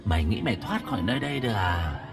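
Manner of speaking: gloating, with high jeering questions; eerie ghost voice, toying with him; mocking taunt. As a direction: sneer at the prey that can't escape. Ghost taunts the trapped man.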